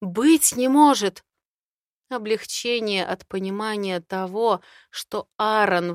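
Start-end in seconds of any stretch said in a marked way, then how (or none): 0.0-1.2 shocked exclamation, stress on может; gasping disbelief. Acted. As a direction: gasp out the disbelief. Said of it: "ахаю я".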